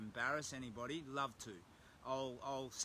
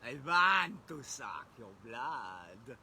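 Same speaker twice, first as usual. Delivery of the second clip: slow, loud hammed-up vampire voice; Dracula; 8 dB louder, much slower than usual. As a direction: drag it out like a cheesy Dracula. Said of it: "Dracula's like".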